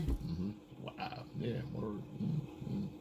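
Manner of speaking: very soft murmured acknowledgements; passive listening. Retold or imitated. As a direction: act out a listener's hushed mm-hmms. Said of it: "and you're listening".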